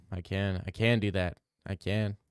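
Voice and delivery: normal talking voice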